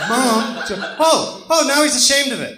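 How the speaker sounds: high, loud laughing jab at mom; excited teasing. Real, not acted. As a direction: tease mom across the room, half laughing.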